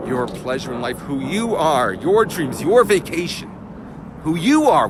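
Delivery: building to a loud, high cry; rising passion. Real, not acted. Teacher to class on what drives you, building to a shout. Impassioned build on life's pleasures.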